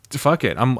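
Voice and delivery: normal talking voice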